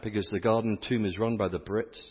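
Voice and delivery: ordinary talk, nothing marked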